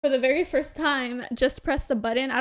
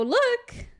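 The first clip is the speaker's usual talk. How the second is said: excited; 12 semitones higher than usual. Retold or imitated. Excited call to partner.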